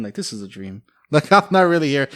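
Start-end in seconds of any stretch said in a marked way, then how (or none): 0.1-0.8 soft, low, hushed wonder; dreamy inner thought. Retold or imitated. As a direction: murmur it half asleep. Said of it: "just thinking".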